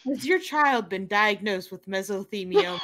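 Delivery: injury lawyer ad. Pretend. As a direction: read it like a lawyer commercial. Parody of a TV ad.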